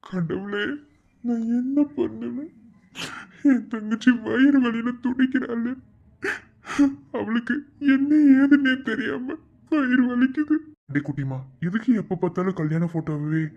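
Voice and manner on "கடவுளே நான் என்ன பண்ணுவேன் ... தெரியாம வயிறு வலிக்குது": high, louder, frantic fretting; anguished brother's panic; panicked worry. Acted. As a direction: cry out helplessly to God. Brother panics over sister's pain.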